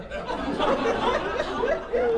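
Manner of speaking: squeaky high laugh; a tense giggle; high nervous giggle. Real, not acted. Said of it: "Lamont is out".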